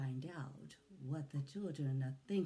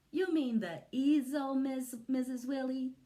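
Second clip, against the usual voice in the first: put-on higher, louder kid voice; high childish correction; children correcting; 7 semitones higher, 6 dB louder than usual. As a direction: correct the teacher like an eager child.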